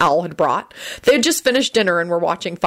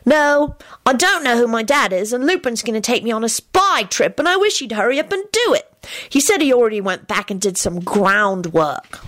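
put-on high, emphatic child voice; impatient boy; 6 semitones higher than usual. Timothy explains about his dad to Alex, impatient. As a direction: complain eagerly like a young boy.

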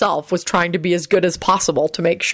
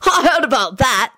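put-on higher boyish voice with a grin; grinning; 7 semitones higher than usual. A narrator's reading. Alex laughs at Timothy's story, grinning. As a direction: say it through a grin. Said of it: "he grinned".

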